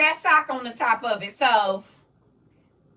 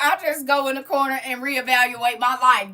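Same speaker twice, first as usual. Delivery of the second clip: ordinary delivery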